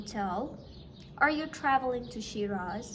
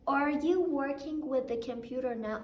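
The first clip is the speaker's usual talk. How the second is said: ordinary delivery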